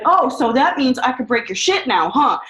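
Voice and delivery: high, loud sarcastic outburst; furious sarcastic shout at husband; going off. Retold or imitated. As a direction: throw his behaviour back at him, loud. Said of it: "And I went off".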